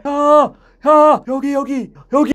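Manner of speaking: high call that never gets sent out; misdirected hail, fading in strength; held-back calling. Shown as a demonstration. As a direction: call out but keep the sound back inside. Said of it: "이렇게 하는 사람은 아마 안 계실 거예요".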